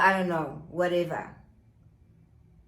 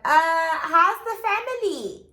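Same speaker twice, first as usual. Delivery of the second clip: high, sly nosy questioning; mock probing; 8 semitones higher, 5 dB louder than usual. Friend teasing her ex about his family, sly high voice. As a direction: needle him sweetly about his family. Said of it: "she's like".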